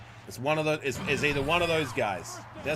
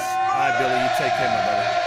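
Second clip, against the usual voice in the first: loud hyped farewell to Billy; loud send-off, still pumped; shouted goodbye; 9 dB louder than usual. Real, not acted. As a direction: keep the chant energy and shout it. Shouted send-off after the chant.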